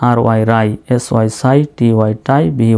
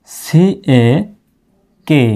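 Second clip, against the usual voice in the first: slow spelling demo; 3 semitones higher, much slower than usual. Shown as a demonstration. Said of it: "দুইভাবে লিখতে পারেন".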